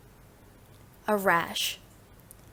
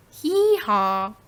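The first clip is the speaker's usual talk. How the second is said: donkey bray; 4 dB louder than usual. Shown as a demonstration. Teacher demonstrating animal sounds, spoken donkey bray. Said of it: "Animal sounds".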